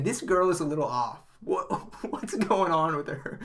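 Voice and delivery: can barely get it out for laughing; laughing through it. Real, not acted. Retelling the joke to a friend, laughing through the words.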